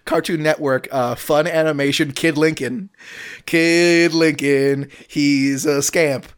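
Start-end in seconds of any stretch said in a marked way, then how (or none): 3.5-4.9 dramatic title. Shown as a demonstration. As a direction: Kid Lincoln stretched out, very slow, like a trailer voice. Pitching a cartoon title.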